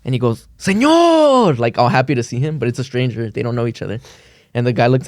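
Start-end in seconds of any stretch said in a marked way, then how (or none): 0.6-1.6 high, delighted call; joyful greeting. Retold or imitated. Son greeting random old man, delighted high call. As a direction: greet him like an old friend. Greets stranger all happy.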